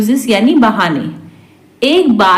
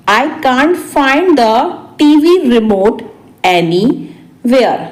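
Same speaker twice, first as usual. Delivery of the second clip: spaced-out repeat. Shown as a demonstration. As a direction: break it up for learners. Teacher repeats translation word by word. (रुक-रुककर, आखिरी शब्द खींचकर)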